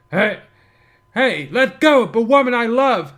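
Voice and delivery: loud, high heroic shout; Spike voice. Acted. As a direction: bellow it like a cartoon hero. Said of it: "I'm instead doing a voice of the guy who voiced Spike".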